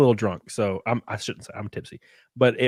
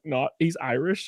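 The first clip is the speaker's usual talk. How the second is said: ordinary talk, nothing marked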